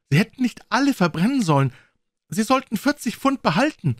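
put-on high, loud young man's reproach; crying out. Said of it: "rief er". (hoch und aufgebracht gerufen)

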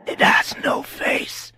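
slow rasping whisper to itself; creature whisper. A narrator's reading. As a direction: breathe it out, unsettled. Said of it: "the thing whispered to itself".

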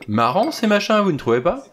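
put-on a young man's lively exclamation at dinner; exclaiming. A narrator's reading. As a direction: toss it out to the table, amused. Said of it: "Anthony Marston s'exclama".

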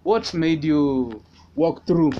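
ordinary delivery

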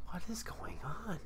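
imitated hushed, puzzled whisper; soft whispered question, retold; confused whisper. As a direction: whisper it in bewilderment. Asking secretly about giggling coworkers.

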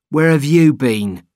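loud, slow and cross; angry. A narrator's reading. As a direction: demand it angrily. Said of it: "he said angrily".

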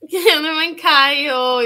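loud, slow, drawn-out call to Kayo; loud protest. Real, not acted. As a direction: drag out the name in mock outrage. Scolding a viewer in chat.

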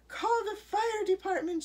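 loud, high cry for help; frantic storybook alarm. A narrator's reading. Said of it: "she cried".

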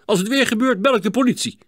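loud, high, furious threat; angry shout. Acted. Neighbour threatens boys with the police, angry shout. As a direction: scold and threaten loudly. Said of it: "riep meneer Bleker boos".

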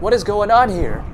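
high, loud alarmed yell; driver yells. A narrator's reading. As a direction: yell it in confusion. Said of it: "the driver yells".

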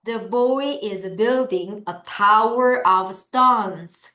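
very slow, word by word read-along; slow choral reading. Shown as a demonstration. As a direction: read it slowly so the class can follow. (아주 천천히 또박또박 같이 읽기)

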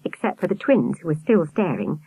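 plain reading voice

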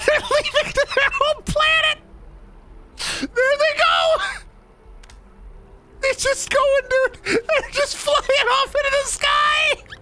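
very high, squeaky words through laughs; helpless laughter. Real, not acted. Player watching them fly away, in hysterical laughter. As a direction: struggle to speak while cracking up.

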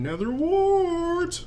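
Nether Wart stretched high and slow; drawn-out high cry of Nether Wart; excited exclamation. Real, not acted. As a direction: sing out Nether Wart with glee. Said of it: "excited item find".

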